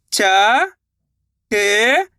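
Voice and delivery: च, a long pause, then ट; letter-by-letter sounding out. Shown as a demonstration. Teacher models reading for beginners, च and ट pronounced separately. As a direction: isolate च, then draw out ट. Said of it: "एक एक अक्षर का उच्चारण करना".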